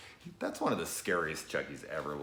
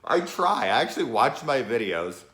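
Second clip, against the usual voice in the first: exasperated loud rant; 9 semitones higher, 10 dB louder than usual. Real, not acted. Complaining loudly to viewers about his sniffing. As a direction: vent about your own sniffing. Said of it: "I can't stop doing that".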